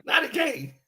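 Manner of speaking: high mock Iverson echo; lightly imitating Iverson; a hint of Iverson's practice rant; Iverson quote. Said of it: "famous words of Allen Iverson".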